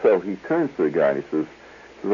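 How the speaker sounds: usual speaking voice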